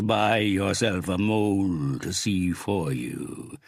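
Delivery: slow, gloating gang boss; menacing crime boss. Acted. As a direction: savor every word, threatening. Big boss voiced in story.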